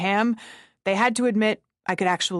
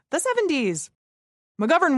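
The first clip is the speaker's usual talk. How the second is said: "the seventies" with "seventies" leaping much higher, then falling; exaggerated high "the seventies"; 8 semitones higher than usual. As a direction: lift "seventies" way up in a comic squeal. Mock exclamation about the era.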